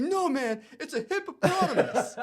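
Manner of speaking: hammed-up high squeal; imitating an insistent freaked-out surfer. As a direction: insist like a guy sure he saw a hippo. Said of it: "He's like".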